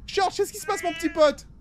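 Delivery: high cooing voice. Real, not acted. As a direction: coo it to a cat. Talks to cat. (voix aiguë et câline pour un chat)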